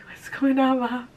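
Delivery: a high puzzled pet voice; dog's voice. Retold or imitated. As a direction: voice the confused dog looking up. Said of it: "my dog looked up at me like".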